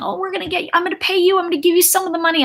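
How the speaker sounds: high, fast mock of empty promises; mocking lying cousin. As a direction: rattle off fake promises in a squeaky mock voice. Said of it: "He kept saying".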